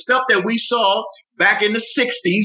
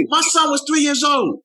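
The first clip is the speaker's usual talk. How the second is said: higher-pitched plea, no actual sobbing; retold distraught mother; 8 semitones higher than usual. Grieving mother on the radio about her little son, distraught. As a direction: imitate a grieving mother's raised-voice protest. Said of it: "She couldn't stop crying".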